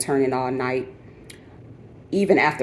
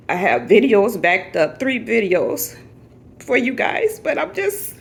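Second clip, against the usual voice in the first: emotional, overwhelmed; 11 semitones higher, 3 dB louder than usual. Real, not acted. Explaining delayed videos to viewers, increasingly tearful. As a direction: voice climbing high into tears. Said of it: "I'm just not emotionally here".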